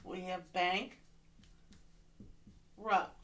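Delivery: "bank" and "rupt" said as separate syllables with a pause; syllable split. Shown as a demonstration. Teacher showing students the syllables, split apart. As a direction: chop it into "bank" then "rupt". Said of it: "now we have two syllables".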